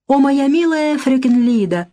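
high, simpering mock sweetness; affected singsong. A narrator's reading. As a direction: sing it out coyly with drawn vowels. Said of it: "жеманясь пропела сестра".

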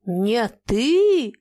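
a trembling angry cry; shaking shout. Acted. Old man crying out at the captain, trembling. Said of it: "крикнул старик и весь затрясся".

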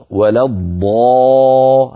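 ض drawn out slowly with full heavy emphasis; heavy dad. Shown as a demonstration. Teacher stretches الضاد to show its heaviness. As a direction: hold الضا- long and thick. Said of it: "هذه الضاد أفخم من ضرب".

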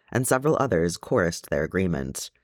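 ordinary delivery, nothing marked

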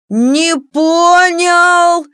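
high, loud, drawn-out indignation; indignant singsong. A narrator's reading. Прыщ balks at his classmates, indignant singsong. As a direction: stretch it out in an outraged singsong. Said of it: "нараспев возмутился Прыщ".

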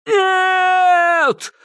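high, loud wailing scream; desperate scream. A narrator's reading. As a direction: scream in despair. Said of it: "завопил бессмертный". (завопил Кощей)

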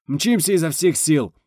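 high, loud, drawn-out eager answer; cheerful reply. A narrator's reading. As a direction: sing out briskly over the radio. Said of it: "бодро откликнулся Бурборыч". (бодро откликнуться)

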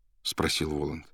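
usual speaking voice

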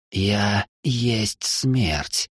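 slow, hissing death voice; whistling s. Acted. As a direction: draw it out like Death herself. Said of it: "неестественно низкий свистящий голос".

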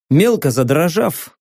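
ordinary delivery, nothing marked

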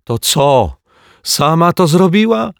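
put-on high, loud indignant child cry; girl's shout. A narrator's reading. Asia cries out at the detective, loud and high. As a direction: shout it like an outraged little girl. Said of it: "krzyknęła Asia".

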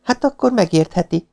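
put-on high, pointed girl's voice; sharp retort. A narrator's reading. Girl answers the Marquis's excuse, sharply. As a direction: retort sharply, pitched well up. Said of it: "mondta élesen Lilian".

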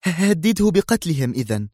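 high female voice, rushing; eager blurt. Acted. Zosha blurting a new plan, high voice. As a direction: burst out with the idea. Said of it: "تندفع قائلة".

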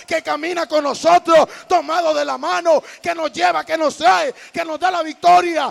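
shouted, rising sermon proclamation; full-force preaching. Real, not acted. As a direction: proclaim it at full volume, phrase after phrase. (prédica proclamada a gritos)

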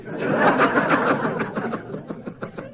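long high breathy laugh; real laugh. Real, not acted. Preacher joking about being paid for commercials, laughing. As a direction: laugh till the breath runs out.

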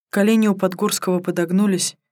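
ordinary delivery

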